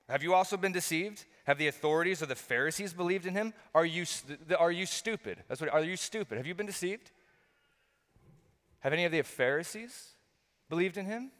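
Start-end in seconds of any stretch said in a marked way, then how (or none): 0.1-5.4 acted Pharisee, higher-pitched disbelieving questions; mock-indignant interrogation, pitched up; incredulous scorn. Acts out scornful Pharisees questioning officers. 5.7-11.3 high, rising rhetorical questions; acted Pharisee disbelief, voice climbing at each question; incredulous scorn. Acts out scornful Pharisees questioning officers.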